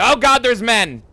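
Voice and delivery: high, loud alarmed yelp; startled shout. Real, not acted. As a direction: jump at enemies out of nowhere. Enemies suddenly appear.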